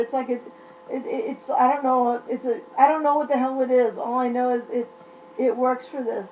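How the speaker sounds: ordinary talk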